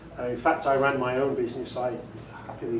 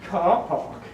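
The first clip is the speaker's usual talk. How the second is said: British "Car park" with no r sounds, "cah pahk"; British accent; 3 semitones higher than usual. Shown as a demonstration. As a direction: answer in crisp British English, dropping the r in "Car park". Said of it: "where do you park your car?".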